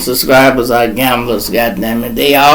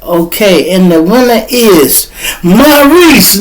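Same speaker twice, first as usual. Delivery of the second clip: slow, raised announcer call; raffle announcer; 6 semitones higher, 3 dB louder, slower than usual. Retold or imitated. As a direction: draw out the winner's name like a host. Raffle announcer calls the winner.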